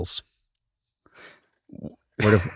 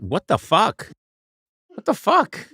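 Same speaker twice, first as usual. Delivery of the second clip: higher, incredulous squawk; hammed-up disbelief, pitch jumping up; mock dying outrage; 9 semitones higher, slower than usual. Comic acting out stabbed Caesar's last words, hammed-up shock.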